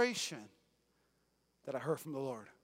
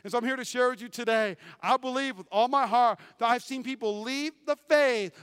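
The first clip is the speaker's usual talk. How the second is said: ordinary talk, nothing marked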